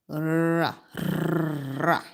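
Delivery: R trilled long like a purr. Shown as a demonstration. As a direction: roll the R like purring. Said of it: "like I'm purring".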